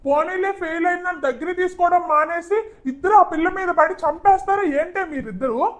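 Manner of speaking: high, loud scolding of the two; angry scolding. Acted. Father scolds mother and daughter, high and loud. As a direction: tear into them for ganging up on her.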